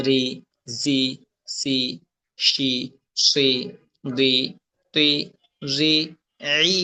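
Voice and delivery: slow, spaced-out letter drill; slowly, one by one. Shown as a demonstration. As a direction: separate syllables with a pause after each. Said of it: "এটা আমি আস্তে আস্তে বলছি". (আস্তে আস্তে, একটা একটা করে)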